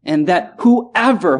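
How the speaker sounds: ordinary talk, nothing marked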